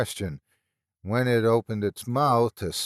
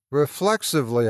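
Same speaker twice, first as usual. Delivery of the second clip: ordinary delivery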